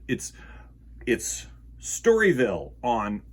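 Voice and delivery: usual speaking voice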